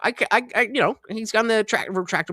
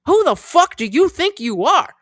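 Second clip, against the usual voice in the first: high, loud indignant challenge; retold screaming match; 10 semitones higher, 5 dB louder than usual. Dog walker in a screaming match, indignant shout. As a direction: throw the challenge at him, raised voice. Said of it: "we got into a screaming match".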